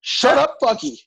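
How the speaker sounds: sharp, high, loud "Shut up"; yelling at cat. Real, not acted. Comic yells at his noisy kitten to shut up. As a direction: shout it across the room at the cat.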